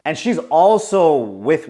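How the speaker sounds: ordinary talk, nothing marked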